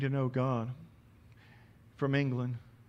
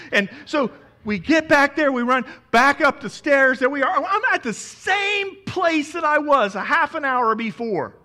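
loud, high, breathless retelling of the airport rush; excited preaching story; 9 semitones higher, 11 dB louder than usual. Acted. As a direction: preach the panic of running late at full force.

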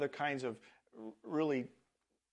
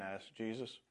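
usual speaking voice

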